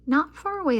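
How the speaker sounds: ordinary delivery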